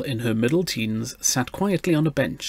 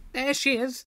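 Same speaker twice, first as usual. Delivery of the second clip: high, softened calling-out; distant cry; 13 semitones higher, 5 dB quieter than usual. A narrator's reading. Mother spots her missing daughter, high anxious cry. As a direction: cry out high, held back in volume. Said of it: "she heard a cry behind her".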